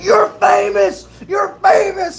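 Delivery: high, drawn-out excited yell; imitated fan screaming. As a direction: act out a kid shouting in disbelief. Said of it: "screaming in the mess decks".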